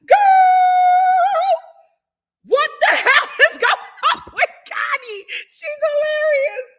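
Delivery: very high loud laughing call; laughing shout. Real, not acted. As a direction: squeal it while cracking up.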